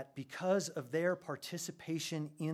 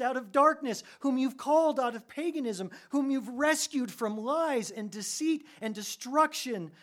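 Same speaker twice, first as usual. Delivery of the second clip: high, loud, rapturous prayer voice; Paul praying; 13 semitones higher, 9 dB louder than usual. Retold or imitated. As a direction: pour it out like an ecstatic prayer. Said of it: "and he says".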